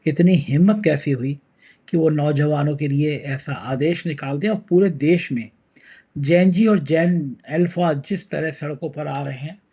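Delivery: ordinary delivery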